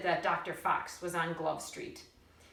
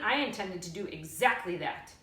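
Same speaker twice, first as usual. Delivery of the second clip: plain reading voice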